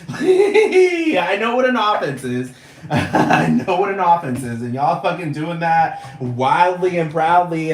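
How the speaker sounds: amused outrage, cackling as she talks; laughing rant. Real, not acted. Laughing while ranting.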